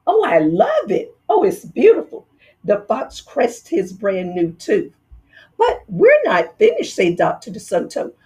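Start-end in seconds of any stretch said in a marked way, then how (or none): 0.1-2.3 high, gushing delight; delighted fox. Acted. Fox gushing over his gold tooth, high and delighted. 5.6-7.0 high, pointed mouse dentist; sly dentist. Acted. Dentist stopping the fox, high and pointed. Dentist springing his trick.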